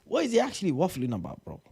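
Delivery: high, exasperated disbelief; incredulous. Real, not acted. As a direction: pitch it way up in disbelief. Mocking a manager's interview clip.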